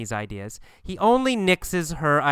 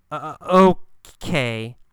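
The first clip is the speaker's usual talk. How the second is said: stammered, broken start; hesitant; 4 dB louder than usual. Acted. Kathy reluctantly answers Gabriel, hesitant. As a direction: stall on the first sound, then drop onto the rest. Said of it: "What does she say hesitantly?".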